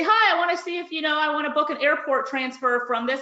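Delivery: a hint of an eager customer asking; voicing the caller.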